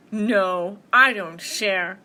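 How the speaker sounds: loud, slow bear refusal; refusing bear. Acted. Bear refusing to share, loud and emphatic. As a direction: boom it out, stretching the words.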